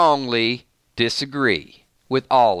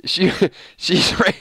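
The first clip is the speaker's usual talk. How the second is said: higher voice breaking into laughter; laughing; 5 semitones higher than usual. Real, not acted. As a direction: try to talk through the giggles. Still laughing while reading the comment.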